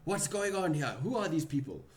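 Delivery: high, loud demanding shout. Acted. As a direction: shout it out in alarm. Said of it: "he kinda shouts at you".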